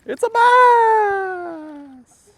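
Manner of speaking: long, high, drawn-out cry of joy; ecstatic shout. Real, not acted. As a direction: yell it out, thrilled. Lands a bass on the ledge.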